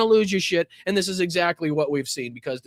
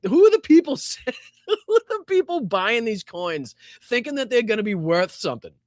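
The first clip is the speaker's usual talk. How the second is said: laughing question, pitched up; incredulous laughter; 5 semitones higher than usual. Real, not acted. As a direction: crack up while asking it. Cracking up at scammed coin buyers.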